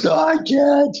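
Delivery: high, loud refusal; high pleading voice. Retold or imitated. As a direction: plead you can't. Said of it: "He goes".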